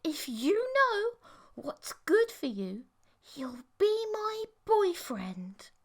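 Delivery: a sweet threat that turns icy; creepy threat. Acted. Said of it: "narrowed her eyes".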